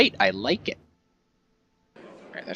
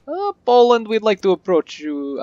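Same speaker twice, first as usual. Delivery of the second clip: lightly put-on Russian accent, higher pitched; 10 semitones higher, 4 dB louder than usual. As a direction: slip into a mock Russian accent. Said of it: "I don't know why I turn Russian".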